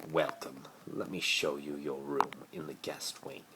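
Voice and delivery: cold, unvoiced sneering whisper to his guest. A narrator's reading. Lucius greets Harry at the door, sneering whisper. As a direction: hiss it out with icy disdain. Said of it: "he sneered".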